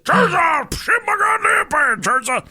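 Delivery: high, desperate mock screaming; hammed-up, strained bellow of distress; husband yelling. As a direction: holler repeatedly for the wife. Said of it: "Fucking yelling at her".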